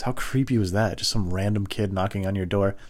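normal talking voice

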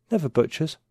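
usual speaking voice

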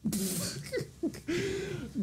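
high squealing laugh. Real, not acted. Friend's joke lands.

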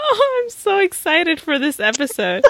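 giggling through the words; fighting back laughter; trying not to laugh. Real, not acted. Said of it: "I can't even look at you".

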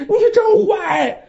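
put-on a sobbing kid shouting accusations, pitched high; crying child's shout. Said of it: "咣咣咣咣大喊".

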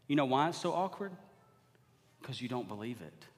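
usual speaking voice

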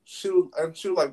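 ordinary talk

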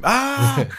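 sudden high yelp; pained yelp. Acted. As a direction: yelp as if bitten. Creature bites him.

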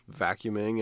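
ordinary talk

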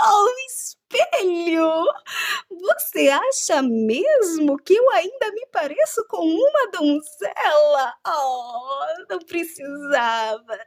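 high, slow, mock-coy queen; fishing for compliments, drawn out; flattered evil queen.

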